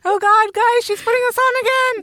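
loud, high comic alarm; mock panic. Seams warning each other, mock panicked. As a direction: warn the others in hammed-up dread.

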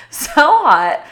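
high, loud laughing gush; excited swoon. Retold or imitated. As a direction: gush over him with a laugh. Swooning over cute guy.

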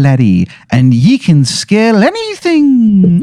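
high, slow Irish leprechaun; sly Irish leprechaun, slowly. Acted. Leprechaun Izzy Irish talking.

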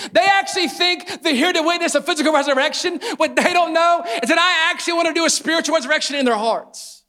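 high, loud preaching of Jesus' thoughts; preaching at full force. Acted. Pastor voicing Jesus to the congregation, full force. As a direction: proclaim it over the crowd.

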